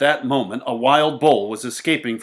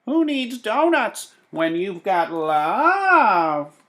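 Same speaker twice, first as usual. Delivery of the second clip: put-on high, slow old woman voice, a bit louder; dreamy old woman; 8 semitones higher, 5 dB louder, much slower than usual. A narrator's reading. The old woman musing about love, slow high old voice. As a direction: draw it out slowly as the old woman. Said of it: "she said".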